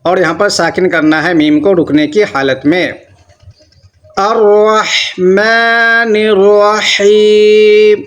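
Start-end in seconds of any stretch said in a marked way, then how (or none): 4.2-8.0 الرحمن الرحیم with ح from the throat, slow; slow recited الرحمن الرحیم; throat ha recitation. Shown as a demonstration. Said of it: "یہ بھی بڑی ہا ہے".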